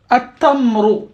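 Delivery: At-tamru with doubled t and no l, said clearly; correct pronunciation. Shown as a demonstration. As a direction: model At-tamru, lam silent, t geminated. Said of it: "je rajoute le déterminant L". (At-tamru, sans l, t doublé)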